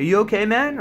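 imitated stranger's concern; concerned passer-by. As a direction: call out a check-in, pitch way up. Voices passers-by checking on him.

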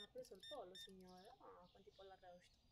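ordinary talk, nothing marked

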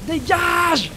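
high panicked yell; frightened shout; screamed get away. Real, not acted. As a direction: shriek it to push it back. Monster attacks again.

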